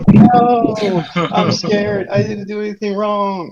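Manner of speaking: high, loud panicked whimper; mock scared plea. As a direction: play the terrified private begging. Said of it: "I'm like".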